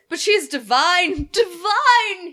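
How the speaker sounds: high, loud, slow theatrical cry; imagined scream. Retold or imitated. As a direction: swoon out loud over her like a lovesick fool. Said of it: "He screams, I imagine".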